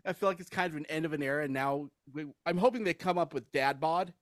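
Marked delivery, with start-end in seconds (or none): none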